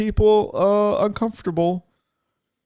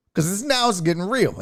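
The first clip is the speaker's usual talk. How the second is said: ordinary delivery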